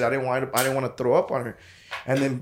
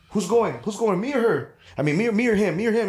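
eager squabbling; 6 semitones higher than usual. Retold or imitated. Friends quarrel over who goes next.

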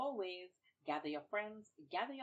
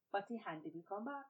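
ordinary delivery, nothing marked

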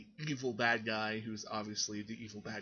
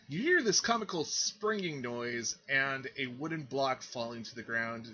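ordinary talk, nothing marked

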